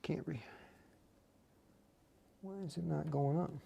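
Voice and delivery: ordinary talk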